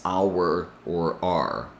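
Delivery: "our" first like "are", then like "hour"; two pronunciations of our; our two ways. Shown as a demonstration. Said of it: "how she pronounces".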